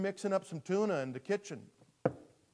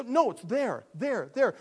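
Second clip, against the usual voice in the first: loud, insistent and raised; hammed-up impatience; exasperated pointing out; 4 semitones higher, 8 dB louder, slower than usual. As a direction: insist loudly, as to a clueless cat. Owner pointing it out to cat.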